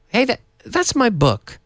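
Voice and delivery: high, surprised "hey, that's my book"; surprised recognition. Shown as a demonstration. Teacher acting out spotting his book, surprised. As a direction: notice your book and exclaim. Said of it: "spotting own book".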